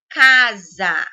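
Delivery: casa loud, with CA drawn out hard; stress on first syllable. Shown as a demonstration. Teacher giving a paroxytone example, stress on CA in casa. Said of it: "K é mais forte".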